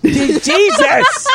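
high, drawn-out startled cry; startled exclamation. Real, not acted. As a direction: jump at the intrusion.

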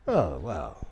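low, soft letdown; subdued private reaction; muted disbelief. Acted. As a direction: take it in quietly.

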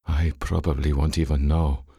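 inner thought. A narrator's reading. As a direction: think it privately, pitched low. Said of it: "Val Aldun thought".

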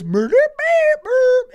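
high, slow squeaky creature voice; squeaky Grimace noise. Retold or imitated. Grimace announcing a murder in a high squeak. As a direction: squeak it out like a cartoon mascot.

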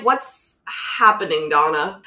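slow, low puzzled question; drawn-out baffled question; slow confused question. Retold or imitated. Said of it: "So I'm like".

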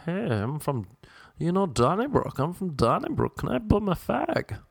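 high-pitched put-on Dublin accent; Dublin Irish accent. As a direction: do a girl at the bar in a lilting Dublin accent. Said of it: "Dublin Irish accent".

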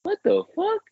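stunned disbelief. Retold or imitated. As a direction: squeak it out like you can't believe your ears. Reacting to Kaepernick's arrogance.

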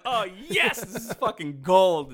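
loud, high gleeful exclamation; retold delight. Receiving a pile of joke stickers, retold gleeful cheer. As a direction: crow over the prize, loud and high.

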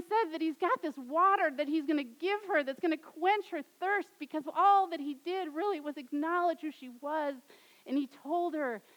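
normal talking voice

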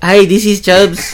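slow, high parody of a bad Latin accent; hammed-up Latin accent, drawn out; mock Latin accent. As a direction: mimic your friend's terrible Latin accent. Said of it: "That's a shitty Latin accent".

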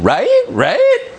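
very high mocking upturn at the end; sing-songy upspeak. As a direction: mimic her in a squeaky, questioning lilt. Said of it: "talking in a sing-songy voice. Inflection at the end".

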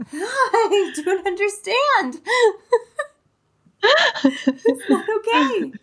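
words broken up by laughter; can't get through it without cracking up; laughing while talking. Real, not acted. Mom laughing about her kids' messy house.

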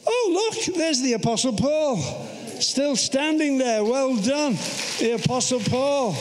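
high-pitched, playful amazed congratulation; mock astonishment. Preacher hailing the Apostle Paul still standing, mock-amazed. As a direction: pretend to spot a celebrity, pitched well up.

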